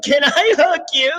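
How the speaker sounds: very high tearful mock wail; imitated bawling. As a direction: imitate someone bawling as she asks. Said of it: "she starts bawling".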